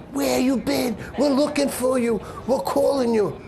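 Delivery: high, urgent and relieved; relieved excited greeting. Retold or imitated. Chauffeur finding Louis alive, high excited relief. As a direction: blurt it out in a rush of relief. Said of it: "He starts going to me".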